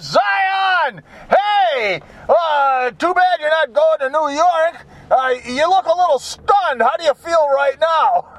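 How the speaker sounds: perky reporter. Retold or imitated. ESPN reporter rushing up to a stunned rookie, high and perky. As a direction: play the peppy sideline reporter bursting up to him. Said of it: "this chickie poo from E S P N runs up to him and says".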